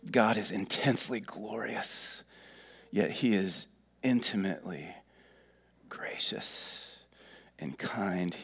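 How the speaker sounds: hushed, slow, intimate reread; the same line again, now hushed, whispered at the end. Shown as a demonstration. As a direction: drop to a near whisper on gracious. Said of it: "I'm gonna read it multiple times".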